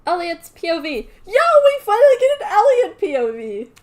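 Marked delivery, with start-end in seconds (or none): 1.3-3.7 high, loud excited shout. Real, not acted. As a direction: cheer it out. Streamer excited by chapter.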